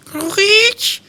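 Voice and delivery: very high, squeaky creak; floorboard screech. Retold or imitated. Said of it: "one of the planks ... screeches".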